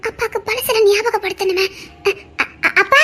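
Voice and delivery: soft sobbing, breaking into stutters; crying. Acted. Daughter begging father to remember, sobbing. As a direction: sob out the words to reach him.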